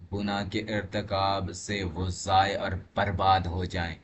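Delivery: normal talking voice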